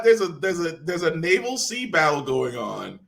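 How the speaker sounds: ordinary delivery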